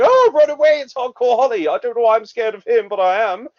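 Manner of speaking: high, loud fake panic; mock scared squeal. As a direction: ham up a cowardly run-away cry. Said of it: "Lesnar's like".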